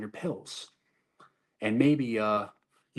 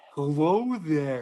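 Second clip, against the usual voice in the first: slow, higher, louder creepy greeting; creepy pervert; 4 semitones higher, 5 dB louder, slower than usual. Retold or imitated. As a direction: drag out a sleazy hello. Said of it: "fucking pervert".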